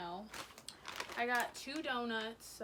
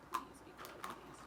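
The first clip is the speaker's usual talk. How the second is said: hushed whispered plea; whispering; 7 dB quieter than usual. Real, not acted. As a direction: whisper a little prayer. Praying the drink tastes good.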